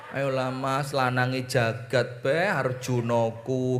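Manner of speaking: soft wheedling through a giggle; the wife flatters her husband, softly and laughing; coaxing with a laugh. Acted. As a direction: sweet-talk him, half laughing. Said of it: "ngomongnya ngerayu".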